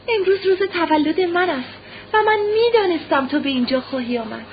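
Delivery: put-on high-pitched little boy voice, eager; innocent child. A narrator's reading. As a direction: confide in mother like a trusting child. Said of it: "با آن لحن معصومانهٔ خود به مادرش گفت". (با لحن معصومانهٔ کودکانه)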